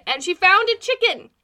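high, loud excited reveal. Real, not acted. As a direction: burst out with the news. Punchline of the chicken story.

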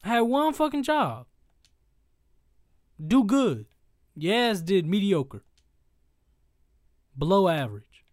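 loud, slow, scornful ranting; angry rant. Acted. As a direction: tear into him, loud and disgusted. Loud rant roasting Deji's fighting.

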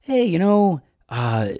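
high, soft, hesitant voice; very gentle. Retold or imitated. Imitating Russ Moore on Trump, very gentle and tentative. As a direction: tiptoe through the criticism, high and soft with halting pauses. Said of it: "very gently say things like".

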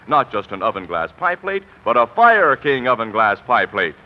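ordinary delivery

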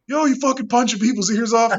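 high loud teasing yell; rowdy bar crowd jeering at the manager, loud mocking yell; mock shout. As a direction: razz him loudly like a rowdy coworker. Coworkers mocking him on arrival.

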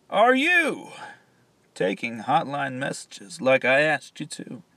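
very loud, high yell. Acted. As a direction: yell it shrilly across the room.

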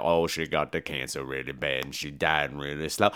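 low, soft storyteller's rumble; deep Tony Todd voice. Retold or imitated. Tony Todd's character tells the backstory, low and soft. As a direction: drop way down and spin the tale low. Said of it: "She's like".

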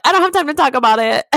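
fast, high, frazzled brush-off; stressed brush-off. Retold or imitated. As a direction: rattle it off, too stressed to deal. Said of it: "And I'm like".

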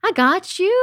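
high-pitched, soothing coo; acted reassurance. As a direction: comfort it as if cooing to a child. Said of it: "not angry with myself".

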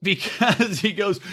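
normal talking voice

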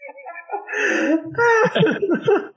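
very high squealing laugh; shrieking laugh. Real, not acted. Telling friends his hospital story, cracking up in a high shriek. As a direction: break into a high wheezing laugh.

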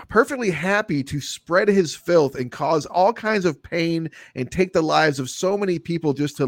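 ordinary delivery

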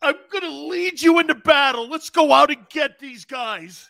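rally the troops while bawling; crying battle cry. Retold or imitated. Coach rallying his team through mock tears, loud and high. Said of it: "He's crying like this".

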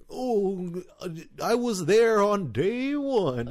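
high, slow, drawn-out boast; mock-grand reminiscing. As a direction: stretch it out like a tale for the grandkids. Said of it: "I don't know why I'm talking like this".

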